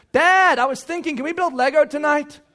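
put-on child begging to build Lego; eager child. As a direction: ask like an excited kid. Child interrupting again.

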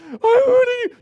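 put-on high, loud demanding child yell; selfish scream. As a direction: shriek the demand. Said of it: "the screams have changed".

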